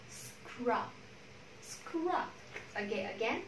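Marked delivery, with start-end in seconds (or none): 0.1-2.1 "Scrub" with s, k and r released cleanly, no vowel between; consonant cluster drill. Shown as a demonstration. Teacher modelling three consonants together, careful "Scrub". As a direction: softly spell out the cluster in "Scrub". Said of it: "chỉ bật âm đấy ra thôi".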